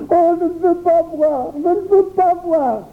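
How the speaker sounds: put-on high, panicked child's cry; cri effrayé. A narrator's reading. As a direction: cry out and run away. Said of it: "s'écria Jacques en se sauvant". (cri aigu d'un enfant qui s'enfuit)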